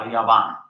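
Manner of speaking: Yaban for Japan, Y for J and B for P. Shown as a demonstration. Teacher showing Arabs' word for Japan, B in Yaban. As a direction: say Yaban with a firm B. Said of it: "you cannot pronounce P".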